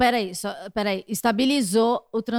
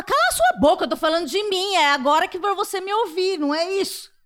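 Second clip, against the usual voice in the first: desabafo encenado, bem mais agudo; mock outburst, high-pitched telling-off; irritada; 6 semitones higher than usual. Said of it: "que ódio que dá isso". (desabafo irritado de brincadeira, voz aguda)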